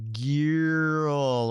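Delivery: put-on "girl" stretched, with a glide like G-I-E-R-L; slow, elongated "girl" with an ee glide; drawn-out "gierl". Shown as a demonstration. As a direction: pull out "girl" as gee-url. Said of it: "like, G I E R L".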